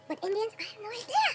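ordinary talk

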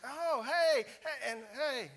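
high, loud fake-friendly hey; forced cheery greeting. Pastor greeting church members from roadside, forced cheer. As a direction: wave at passing church members sheepishly. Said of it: "And I'm like".

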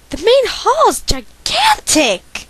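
put-on high, slow, amazed girl's voice; character voice. Girl entering the mansion, high and slow with wonder. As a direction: marvel at the house slowly, pitched high. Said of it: "why do I feel the need to do voices".

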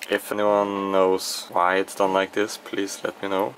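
normal talking voice

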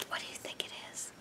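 put-on hushed, spooky whisper to the children. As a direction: whisper it like a secret in the dark. Scared ducklings whisper.

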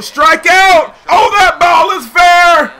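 real joy calling the hit; excited shout. Real, not acted. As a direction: shout it out as the runs score. Shouting as hit drops fair.

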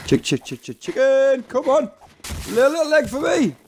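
high sing-song chick chick; calling chickens. Real, not acted. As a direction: coax it in a high voice.

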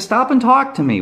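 high-pitched retold demand; mildly insistent call, no real shout. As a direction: call after someone riding away, retold. Calling after a passing cyclist.

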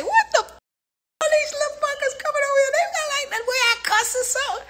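very high-pitched, squealing disbelief; retold indignant outburst. Baffled by youngins coming over, high indignant squeal. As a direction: protest in a hammed-up squeal.

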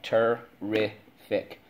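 TERRIFIC slowly as ter, ri, fic; corrected syllable split. Shown as a demonstration. Teacher fixing own mistake, syllable by syllable. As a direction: break Terrific up with the f starting the last syllable. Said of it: "should go in the third syllable".

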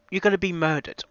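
ordinary talk, nothing marked

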